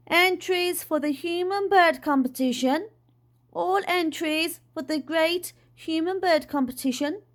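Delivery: high, sustained announcer's call; booming call. A narrator's reading. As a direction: call it out to the whole street. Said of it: "the voice was so loud".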